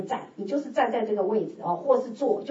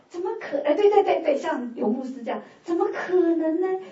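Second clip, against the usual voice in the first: incredulous; 7 semitones higher, 3 dB louder than usual. Retold or imitated. Acting out her disbelieving thoughts.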